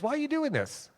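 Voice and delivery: high-pitched, puzzled demand; imitated insistent questioning. Man questioning the donut giver, imitated insistent asking. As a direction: mimic someone baffled who wants an answer. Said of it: "he just kept asking".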